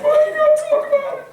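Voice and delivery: very high, loud, fast mock complaint; hammed-up whiny relative; mock shrill relative. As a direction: squeal it like an upset aunt. Mocking upset relative.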